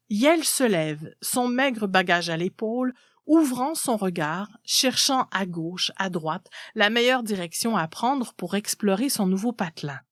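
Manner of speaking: ordinary delivery, nothing marked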